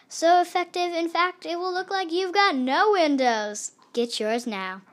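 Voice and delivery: upbeat mock commercial pitch; ad read. Narrator interrupting the story with a mock ad, upbeat. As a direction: sell the window cleaner like a TV ad.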